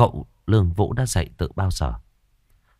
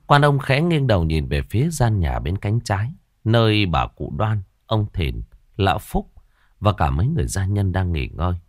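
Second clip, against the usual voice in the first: ordinary delivery, nothing marked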